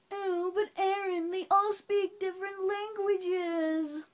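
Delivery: high-pitched, slow whiny objection; squeaky mock complaint, drawn out; mocking objector. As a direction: mimic a protesting viewer in a high voice. Mimicking a viewer's objection.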